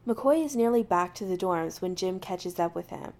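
ordinary delivery

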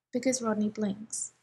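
plain reading voice

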